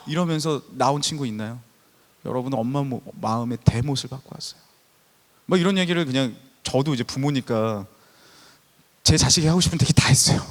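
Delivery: loud, fervent sermon voice pressing on the youth; impassioned revival preaching; preaching at full force. Acted.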